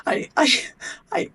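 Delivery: high, breaking words through retold tears; a touch of sobbing as she re-lives it; retold crying.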